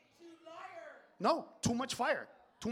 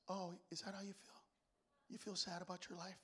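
mock-tender wife; 16 dB quieter than usual. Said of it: "my wife will go like this".

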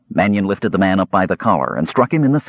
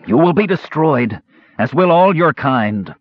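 hoarse cry; 7 semitones higher than usual. A narrator's reading. Broken minister cries out hoarsely at his attacker. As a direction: cry out hoarsely, beaten but defiant. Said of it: "Mannion cried hoarsely".

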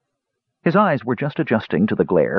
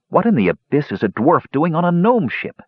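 high-pitched, incredulous demand; 8 semitones higher than usual. A narrator's reading. Brother challenging the dwarf on the gnome ship, demanding. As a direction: demand an explanation in disbelief. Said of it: "Tannen demanded".

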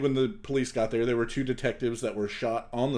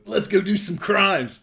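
higher, louder, slow movie-line delivery; quoting Repo Man; 8 semitones higher, 6 dB louder, slower than usual. Retold or imitated. Quoting a Repo Man line to a co-host, lightly acted. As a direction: toss off the movie line with relish. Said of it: "to the movie Repo Man".

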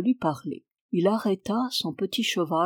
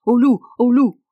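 loud, high alarm call; sudden wolf alarm; clear cry; 4 semitones higher, 9 dB louder than usual. A narrator's reading. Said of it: "j'entends distinctement et clairement ce cri".

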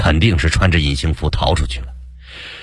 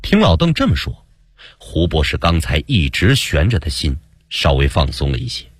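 usual speaking voice

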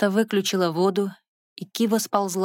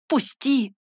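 high, raised cry; yelling; 7 semitones higher than usual. Acted. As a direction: yell to be let go. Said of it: "завопила Кива".

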